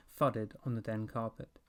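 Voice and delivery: usual speaking voice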